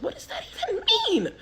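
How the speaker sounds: very high, fast squeal; incredulous mock squeal, rushed; baffled disbelief. Passenger overhearing that her date outranks police, baffled.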